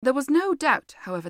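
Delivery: ordinary delivery, nothing marked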